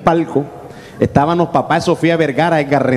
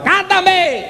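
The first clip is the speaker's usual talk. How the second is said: sudden high-pitched yell; imitated crowd yell; 12 semitones higher than usual. Fan demanding a song from the singer, high imitated yell. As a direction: cry out high like an impatient fan. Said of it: "hasta que le gritaban".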